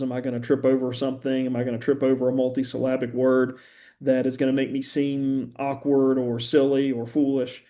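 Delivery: ordinary delivery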